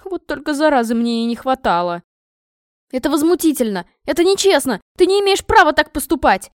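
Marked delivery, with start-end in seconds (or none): 2.9-6.5 high, loud, building outrage; mounting princess indignation; indignant protest. A narrator's reading. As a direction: protest louder with each phrase. Princess protests to her father.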